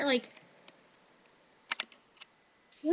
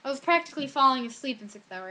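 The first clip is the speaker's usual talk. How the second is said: usual speaking voice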